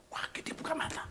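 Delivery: breathy hushed whisper; whispered suspense. A narrator's reading. Preacher telling the congregation the tense moment, whispering. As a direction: lean in and whisper it. Suspense as host opens eyes.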